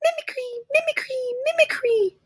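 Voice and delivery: squeaky, very high and fast mimicry; playful falsetto chant; high-pitched voice. Shown as a demonstration. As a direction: go way up into a silly squeak. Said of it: "in a really high-pitched voice three times".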